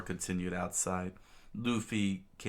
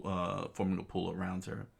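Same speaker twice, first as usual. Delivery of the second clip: ordinary delivery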